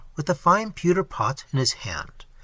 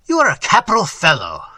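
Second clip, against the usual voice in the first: hearty cry; 2 semitones higher, 6 dB louder than usual. Acted. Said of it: "cried mine host".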